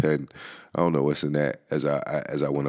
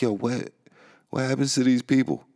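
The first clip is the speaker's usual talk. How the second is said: baffled asking; 7 semitones higher than usual. Retold or imitated. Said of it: "I'm serious when I ask, like".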